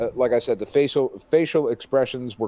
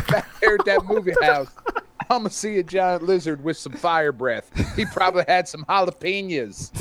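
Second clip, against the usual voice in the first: higher mock southern accent, laughing through it; a light southern accent, laughing; 6 semitones higher than usual. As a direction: keep the hammed-up southern accent going. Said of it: "a southern twang".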